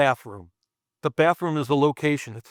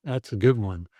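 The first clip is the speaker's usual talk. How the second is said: ordinary talk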